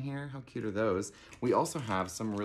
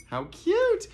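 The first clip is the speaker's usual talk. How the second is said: much higher, louder squeal of delight; 8 dB louder than usual. Real, not acted. Host showing campers the mug, squealing with delight. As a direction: squeal it like you can't contain yourself.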